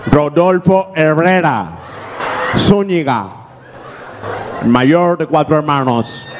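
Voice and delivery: stiff interview answer. Acted. Traveller answers the gringo officer stiffly.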